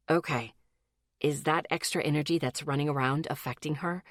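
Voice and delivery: low, calm and controlled male voice. A narrator's reading. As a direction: keep it steady and controlled. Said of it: "in his calm, controlled voice".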